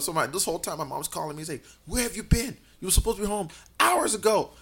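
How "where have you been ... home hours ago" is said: higher-pitched imitation of a cross mother; retold scolding mom. Mom scolding over the phone, retold.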